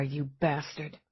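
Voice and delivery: muttered under the breath; quiet inner curse. Acted. Eve silently cursing the approaching killer, hushed. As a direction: curse him softly inside her head. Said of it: "she thought".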